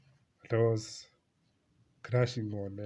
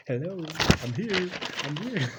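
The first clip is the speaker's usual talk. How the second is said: higher mock girlish voice; a lightly higher hinting girl; girl hinting she's there; 6 semitones higher, 4 dB louder than usual. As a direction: play the girl waving for attention. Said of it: "She's like".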